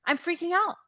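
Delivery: high, loud burst of giddy excitement; reliving the thrill, pitch soaring, words stretched for emphasis; breathless-with-excitement retelling; freaking out. Retold or imitated. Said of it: "I am just freaking".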